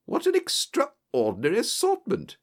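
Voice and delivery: high-pitched, amazed exclamation; acted astonishment, pitch leaping up; astonished exclamation. A narrator's reading. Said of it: "I exclaimed".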